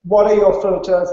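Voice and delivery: normal talking voice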